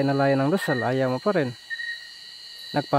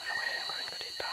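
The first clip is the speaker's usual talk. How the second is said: quick hushed whisper; tense whisper wondering what to do; fast whisper; 12 dB quieter, faster than usual. Real, not acted. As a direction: whisper nervously to yourself. Whispering in fear.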